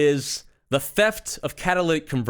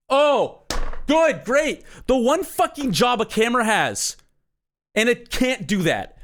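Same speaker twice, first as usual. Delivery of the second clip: sarcastic; 8 semitones higher, slower than usual. Real, not acted. Learning the camera is broken.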